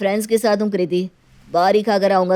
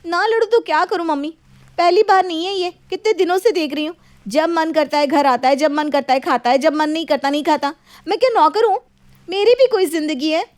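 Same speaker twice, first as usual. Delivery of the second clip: higher, louder heated rant at the husband; wife complaining to her mother-in-law, higher and louder; angry complaint; 7 semitones higher, 4 dB louder than usual. Acted. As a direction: vent at him, voice climbing.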